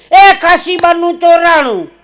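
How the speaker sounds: high, very loud, drawn-out cry; sudden alarm shout. Acted. Night alarm in the train that Kashiba's been robbed, shouted. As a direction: raise the alarm across a sleeping carriage. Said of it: "ઓચિંતાની બૂમ પાડી".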